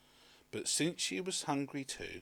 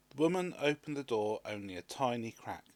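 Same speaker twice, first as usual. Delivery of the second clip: ordinary delivery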